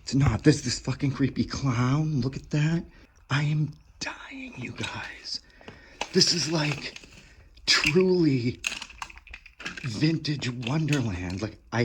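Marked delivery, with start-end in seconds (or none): 3.3-5.4 breathless whisper, overwhelmed with delight; awed whisper. Real, not acted. Collector whispering to viewers over a toy find, awed. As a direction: whisper it like you can't believe your eyes. Overwhelmed finding vintage toys.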